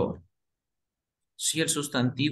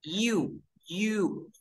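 usual speaking voice

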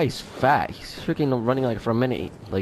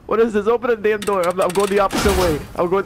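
frustrated yell; 7 semitones higher, 4 dB louder than usual. Real, not acted. Frustrated at locked door.

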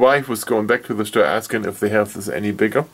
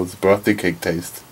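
normal talking voice

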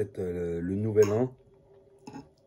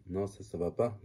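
usual speaking voice

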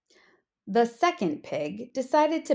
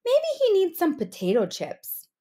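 first pig; 7 semitones higher than usual. Acted. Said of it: "said the first pig".

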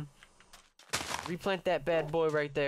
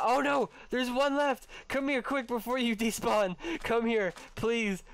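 excited urgent calls; 6 semitones higher, slower than usual. Real, not acted. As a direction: cry out in a rush, half laughing. Last dog about to vanish.

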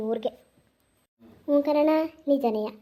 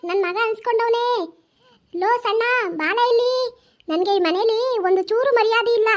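calling out angrily; 5 semitones higher than usual. Acted. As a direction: call him in across the house, indignant. Grandmother calls son angrily.

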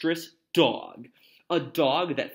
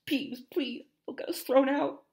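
pleading; 11 semitones higher than usual. Acted. Children pleading with Peeves.

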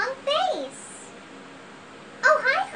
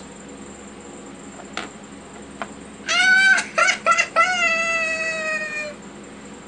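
bratty shriek; 8 semitones higher than usual. Acted. Lola's outburst.

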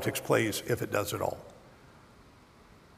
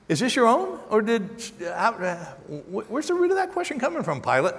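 pushes the question up high and fast, incredulous; paraphrasing Jesus, pressing; 5 semitones higher than usual. Retold or imitated. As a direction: fire the question back high and quick. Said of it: "It's Jesus, I'm telling you".

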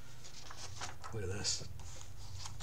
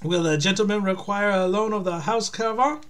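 high, loud French waiter; French accent; 9 semitones higher, 7 dB louder than usual. Acted. Waiter addressing gentleman guest, French accent. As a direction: offer it officiously, French accent. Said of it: "all this fucking French and German".

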